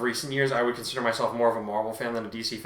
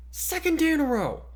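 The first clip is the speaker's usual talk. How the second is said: much higher, fed-up cry; exasperated outburst. Acted. Kurtai complaining about reporters at the gate, high exasperated cry. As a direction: throw up your hands at it. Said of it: "Kurtai groaned".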